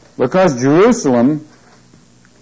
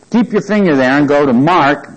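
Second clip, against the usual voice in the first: ordinary talk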